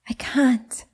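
hushed, voiceless refusal; whisper. Acted. Jada refuses, desperate.

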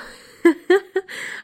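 loud laugh. Real, not acted. Laughs at own joke.

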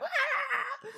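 mock scream. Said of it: "Gray would just be like".

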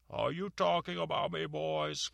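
high, loud, menacing monster; menacing gorgon voice. Acted. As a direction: catch them scheming, menacing. Medusa overhears.

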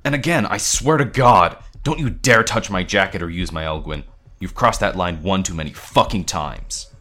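heated threat with punched stresses; furious warning. A narrator's reading. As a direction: lay down the law angrily. Parker threatens Noah, quarrel peak.

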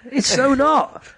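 high squeal of disbelief; emphatic contradiction. Real, not acted. Mock-indignant disagreement with co-host.

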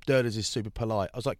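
normal talking voice

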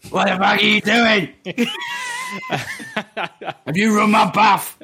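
high, loud caricature of a nagging parent; [name]'s mum as Steptoe. Pretend. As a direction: shout it in a hammed-up sitcom parent voice. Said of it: "My mum is Steptoe".

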